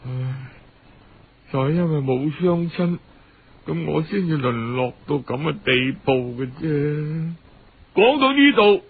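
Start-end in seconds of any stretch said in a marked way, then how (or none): 0.1-7.3 put-on soft, low, sighing girl near tears; a hint of tears as she sighs out her fate; tearful girl. Orphan girl to her uncle, softly tearful. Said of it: "小喬忍不住又喊起上嚟".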